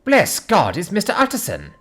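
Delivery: high, relieved exclamation; crying out. A narrator's reading. Cook greeting the arriving lawyer, crying out. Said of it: "crying out".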